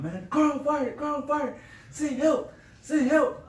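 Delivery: high, pleading voice; panicked 911 call. Retold or imitated. Calling 911 about his burning car, high and panicked. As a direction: beg for help in a rush. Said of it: "I called nine one one".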